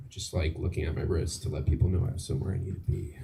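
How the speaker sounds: ordinary talk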